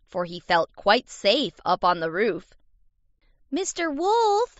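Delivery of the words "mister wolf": high, drawn-out taunting call; taunting kid. A narrator's reading. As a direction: tease from a safe height. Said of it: "began to tease and taunt the wolf".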